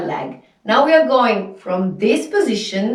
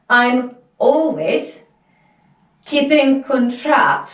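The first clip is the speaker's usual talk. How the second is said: normal talking voice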